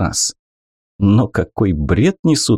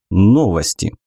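ordinary delivery, nothing marked

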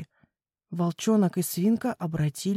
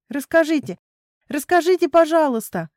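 high, louder eager piglet squeal; 7 semitones higher, 5 dB louder than usual. A narrator's reading. Piglet begging papa for a scary story, squealing. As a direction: squeal the plea, pitched right up. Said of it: "взвизгнула Хвостик".

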